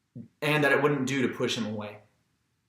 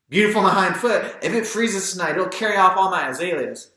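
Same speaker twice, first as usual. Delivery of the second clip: put-on higher, louder cranky old woman; grumpy old lady; 7 semitones higher, 6 dB louder than usual. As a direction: scoff at the snow, fretting over azaleas. Maudie grumbling about snow.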